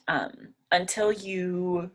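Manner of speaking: ordinary delivery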